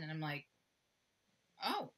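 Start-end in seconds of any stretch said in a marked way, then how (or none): 1.6-1.9 surprised oh. Retold or imitated. Reaction to seven out of ten.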